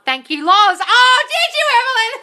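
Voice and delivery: very high, loud delighted squeal; thrilled squealing laugh; ecstatic squeal. Real, not acted. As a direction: shriek with joy for her. Celebrating viewer's news again.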